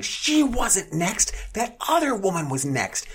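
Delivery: high, slow screeching busybody; mock screech. Woman at the deli screeching at the counter guy, high and slow. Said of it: "another woman screeches".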